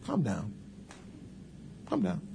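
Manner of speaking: soft, hushed and unruffled; quietly defuse the excitement; calm reply. Retold or imitated. Preacher answering excited staff, soft and calm. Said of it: "they be all excited".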